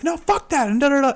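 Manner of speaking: high-pitched acted protest; pissed-off retort. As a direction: reenact an angry outburst, voice shooting up. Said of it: "I was really pissed that day".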